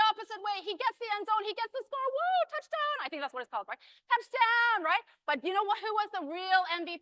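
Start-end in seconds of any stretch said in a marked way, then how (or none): 2.1-3.0 high, whooping sports-fan cheer; touchdown cheer. Acted. 4.1-4.9 high, loud celebratory call; touchdown cheer. Acted.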